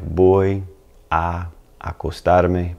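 "Voy a acostarme" said slowly, each "a" kept separate; slowed down. Shown as a demonstration. Teacher slows the phrase so learners hear each part, slow and separated. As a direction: stretch out "Voy a acostarme" so both a sounds are heard. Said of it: "If you really slowed it down".

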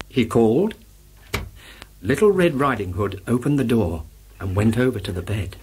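ordinary delivery, nothing marked

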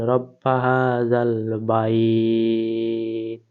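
রব্বা হা জাল বাইত chanted very slowly; slow recitation. Shown as a demonstration. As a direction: draw out each syllable as tilawat. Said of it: "জাল লাম জবর জাল".

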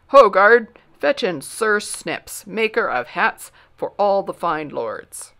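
loud, slow summoning call; royal command. Acted. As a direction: call across the throne room. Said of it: "agreed the king".

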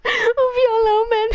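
fake-weep over the guitar music; playful fake crying, voice up high; hammed-up crying. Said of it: "eu vou chorar, gente".

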